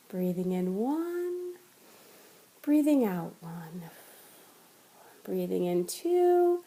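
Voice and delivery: slow, soft counting of breaths; guided breath counting. Shown as a demonstration. Meditation teacher guides viewer through breath counting, slow and soft. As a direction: ease the listener into a slow count. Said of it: "Back to breathing".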